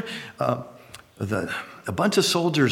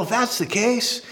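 ordinary talk

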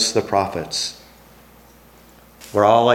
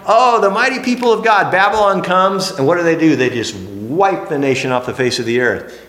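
usual speaking voice

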